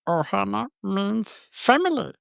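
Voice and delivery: high, slow alien creature rasp; squeaky little alien voice; Stitch voice. Acted. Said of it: "you gotta do it".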